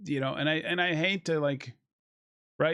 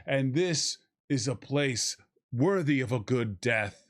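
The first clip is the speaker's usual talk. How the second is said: lower, slow, solemn hammed-up saga voice; mock pompous warrior; 6 semitones lower, slower than usual. Mocking the film's self-serious speeches, in a low slow hammy voice. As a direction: intone it like a grizzled alien warlord.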